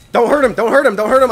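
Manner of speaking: high, loud repeated cries; panicked pleading. Real, not acted. Healer shrieking at the enemy to stop. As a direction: beg in mock panic.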